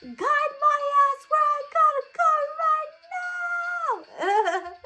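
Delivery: very high, loud, slow desperate cry; hammed-up crying plea to the hair; pleading shout. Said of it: "be like".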